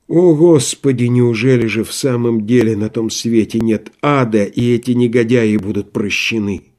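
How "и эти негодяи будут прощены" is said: plain reading voice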